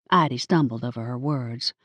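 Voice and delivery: plain reading voice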